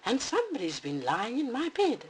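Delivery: Mama Bear squeaking about her bed; squeaky Mama Bear. Acted. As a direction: squeak it indignantly. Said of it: "squeaked Mama".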